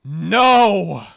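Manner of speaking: loud, high drawn-out cry of "No"; outburst. Real, not acted. Outburst while watching card reveals.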